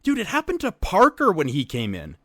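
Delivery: high, loud, agitated; worked-up insistence. Real, not acted. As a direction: pile up examples, indignant. Worked up over unfair treatment.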